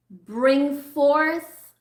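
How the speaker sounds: ordinary talk, nothing marked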